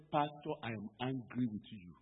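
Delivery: normal talking voice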